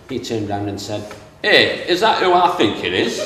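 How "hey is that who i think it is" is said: broad Yorkshire, high and loud with a laugh; Yorkshire accent. Retold or imitated. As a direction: play the cheeky Yorkshireman. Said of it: "in his Yorkshire accent".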